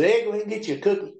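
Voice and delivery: high, fast, sing-song offer; sly coaxing. Retold or imitated. Urging Ed to take the cook's cookie, slyly. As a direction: sweet-talk him into the trap.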